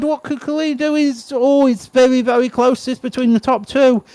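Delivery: high-pitched, excited play-by-play; live race commentary. Real, not acted. Speedway commentator following riders on the inside, excited live commentary. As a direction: call the race as it unfolds.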